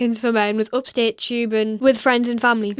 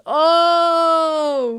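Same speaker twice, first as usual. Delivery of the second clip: long, raised, hammed-up "ohhh"; mock crowd "oh"; 4 semitones higher, 3 dB louder than usual. Reacting to the comeback in the story, mock impressed crowd. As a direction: hold the jeering cheer.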